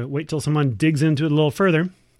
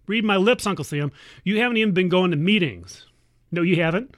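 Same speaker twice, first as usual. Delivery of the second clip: normal talking voice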